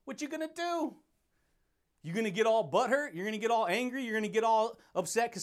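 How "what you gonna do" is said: high mock taunt, softer. Real, not acted. Taunting the viewer who gets mad, high and teasing. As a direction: tease the listener with a lifted voice. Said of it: "taunt repeated higher".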